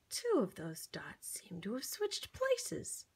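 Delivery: soft whisper, sharing a secret; conspiratorial whisper. Acted. Pointing out a mystery to the child, whispering. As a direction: lean in and whisper it.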